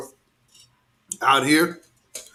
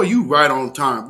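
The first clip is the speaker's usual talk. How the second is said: ordinary talk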